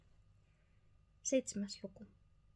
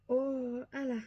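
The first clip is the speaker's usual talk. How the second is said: pleading protest; 4 semitones higher, 6 dB louder, much slower than usual. A narrator's reading. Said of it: "sanoi Antoinette".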